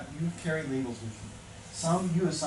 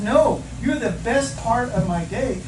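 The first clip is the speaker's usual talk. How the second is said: high, loud, slow declaration; emphatic reassurance; 9 semitones higher, 10 dB louder, slower than usual. Retold or imitated. Husband flipping his wife's label, emphatic reassurance. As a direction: act out a husband insisting with full conviction.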